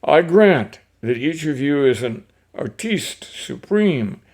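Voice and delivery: relishing each foreign name, lively and rolling; poem reading. Acted. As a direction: read the verse with gusto, savoring every opera singer's name. Reads Ogden Nash poem aloud.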